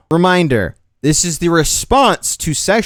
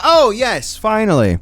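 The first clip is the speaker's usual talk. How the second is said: mock agreement; 9 semitones higher, slower than usual. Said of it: "that you're like".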